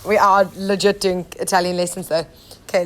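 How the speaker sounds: usual speaking voice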